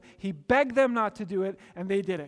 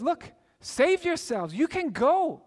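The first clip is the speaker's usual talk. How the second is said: raised, higher and louder plea; urgent Joseph; 8 semitones higher, 6 dB louder, slower than usual. Retold or imitated. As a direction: push the offer out to the brothers. Joseph offers brothers a way out.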